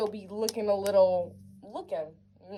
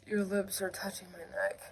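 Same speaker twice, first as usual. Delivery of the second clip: whispery, very soft reading, slowing down; hushed story narration; 3 semitones lower, 21 dB quieter, slower than usual. A narrator's reading. Reader narrating an intimate scene, whispered narration. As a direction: let it fade to a whisper. Reads fiction aloud.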